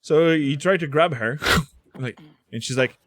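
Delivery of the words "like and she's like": usual speaking voice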